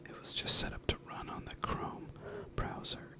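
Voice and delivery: ordinary delivery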